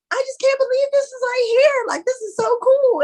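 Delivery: high-pitched, loud, squealing with awe; amazed excitement. Retold or imitated. Retelling his own awe at the party, high excited squeal. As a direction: gush like a wide-eyed fan. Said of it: "I'm like".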